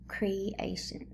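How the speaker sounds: Creation whispered without voice; teacher whispering the answer. Shown as a demonstration. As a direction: breathe Creation softly.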